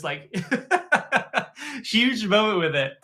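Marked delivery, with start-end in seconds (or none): 0.4-1.8 excited laugh. Retold or imitated. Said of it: "started freaking out".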